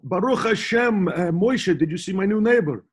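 high, hammed-up Israeli accent; lightly mock Israeli accent, chatty; Israeli neighbour. Gossiping to Moshe about the new neighbour, mock Israeli accent.

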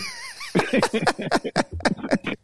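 squealing high laugh. Real, not acted. Big laugh.

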